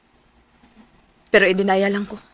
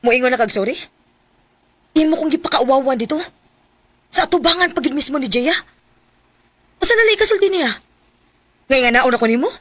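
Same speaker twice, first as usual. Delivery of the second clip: angry reproach; 3 semitones higher than usual. Acted. Girlfriend scolds boyfriend over apology.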